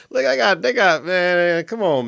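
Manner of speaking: jumps high, then drags the words out slowly; can't believe what he's seeing; excited outburst. Real, not acted. Host reacts to Braden's high.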